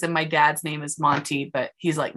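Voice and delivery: normal talking voice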